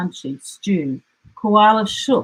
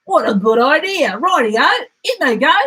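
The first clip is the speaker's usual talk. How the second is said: higher and louder, eager; 7 semitones higher, 5 dB louder than usual. Acted. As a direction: jump at the idea. Dingo eager agreement.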